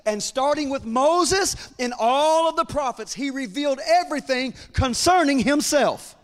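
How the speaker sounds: climbing sermon fervor; full-force preaching. Acted. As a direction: preach it up to a climax.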